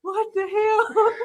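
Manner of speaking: high, slow, laughing disbelief; amused disbelief. Real, not acted. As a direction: squeal it slowly through real laughter. Cracking up at the clip.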